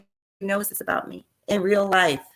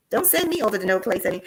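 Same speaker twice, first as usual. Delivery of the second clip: normal talking voice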